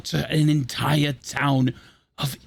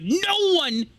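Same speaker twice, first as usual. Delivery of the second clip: high, straining outcry; impassioned villain; 6 semitones higher than usual. Acted. Villain arguing for his choice, impassioned outcry. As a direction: build the tirade to a high-pitched, shouted peak. Villain's speech builds to a climax.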